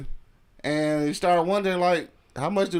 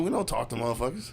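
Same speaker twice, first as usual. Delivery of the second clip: ordinary delivery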